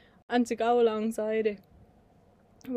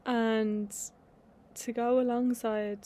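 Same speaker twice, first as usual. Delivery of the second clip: ordinary talk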